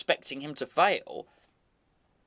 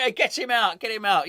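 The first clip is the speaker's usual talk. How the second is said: mock fan shout; 6 semitones higher, 6 dB louder than usual. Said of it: "and quite frankly".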